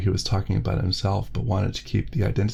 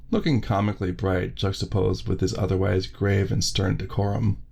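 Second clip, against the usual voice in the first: usual speaking voice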